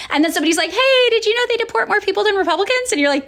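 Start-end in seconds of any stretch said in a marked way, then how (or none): 0.7-2.9 much higher, bright mock informer; spring the fact on them; pointed question. Someone telling a liberal a fact, high mock informer.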